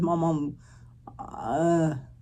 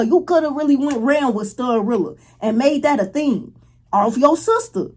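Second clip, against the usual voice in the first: usual speaking voice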